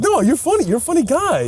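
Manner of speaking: high-pitched parody of movie gangster banter; mock wiseguy. Friend telling touchy gangster he's funny, mock wiseguy. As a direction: play a wiseguy backpedalling, voice up high. Riffing the Goodfellas confrontation.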